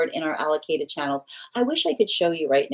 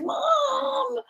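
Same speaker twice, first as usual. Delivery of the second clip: very high drawn-out whine; mock teen whine; whiny daughter. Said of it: "She's like".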